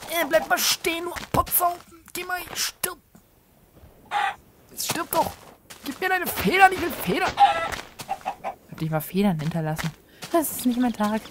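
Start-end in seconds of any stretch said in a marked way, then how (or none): 0.1-7.3 high, squeezed pleading voice; mock-frantic chasing. Player chasing a chicken for feathers, high mock-frantic voice. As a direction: squeak orders at a fleeing animal.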